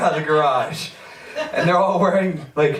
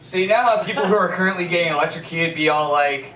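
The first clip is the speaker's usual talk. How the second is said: ordinary delivery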